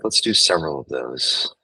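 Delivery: normal talking voice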